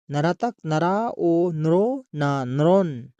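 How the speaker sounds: slow teacher's spelling out of नरतक नरा ओ नरो ना नरोन; slow, syllable by syllable. Shown as a demonstration. Teacher reading नरतक नरा ओ नरो ना नरोन slowly to pupils, syllable by syllable. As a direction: draw out each syllable of नरोन for the class. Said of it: "teacher spelling out syllables".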